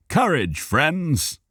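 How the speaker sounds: high, rousing call to friends; rally the friends bravely; encouraging hero. A narrator's reading. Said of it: "Cal encouraged".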